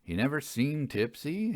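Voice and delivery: usual speaking voice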